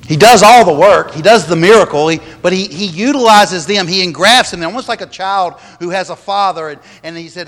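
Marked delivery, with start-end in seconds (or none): 0.1-4.6 loud, driving pulpit emphasis; emphatic preaching. Real, not acted. Pastor to his congregation, loud and emphatic. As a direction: hammer the point home to the church. Pastor builds to the key point.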